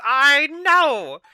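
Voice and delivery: loud, high, hammed-up indignation; mock outrage. Streamer jokes that viewer bullies her, mock indignant. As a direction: play the wronged parent, high and over the top. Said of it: "No, I'm just kidding".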